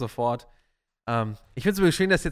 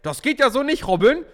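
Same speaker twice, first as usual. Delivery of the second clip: loud mock scolding, pitched up; 7 semitones higher, 9 dB louder than usual. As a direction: play a nagging health cop. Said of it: "Gesundheitspolizisten hier im Live-Chat und sagen".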